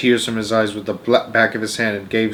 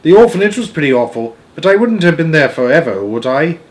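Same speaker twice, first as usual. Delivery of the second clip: lightly voiced worried girl, pitched up; louder, higher storybook child, fretting; worried Sophie; 5 semitones higher, 7 dB louder than usual. Sophie tells the giant she dreads staying forever, worried.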